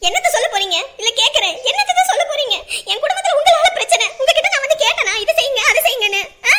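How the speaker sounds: loud, furious demands; angry. Acted. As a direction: confront your parent loudly, fed up. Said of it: "கோவப்படாத மகா". (ஆவேசமாக, கோபமாக)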